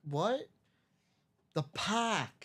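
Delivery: light Boston "park" with no r; Boston "The park", r dropped; Boston accent. Retold or imitated. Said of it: "thick Boston accent".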